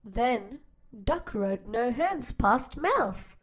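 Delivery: ordinary delivery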